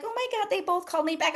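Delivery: quick and high, gushing; excited retelling. Retold or imitated. Friend who got callbacks, high and fast with delight. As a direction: rattle it off high and fast like good news. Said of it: "she's like".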